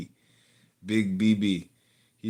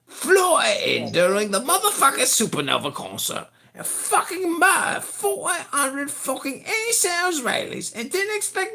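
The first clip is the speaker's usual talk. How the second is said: swearing tirade that climbs much higher; furious rant. Real, not acted. Ranting at the caller about October seventh, escalating furious shout. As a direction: build from heated to shrieking fury.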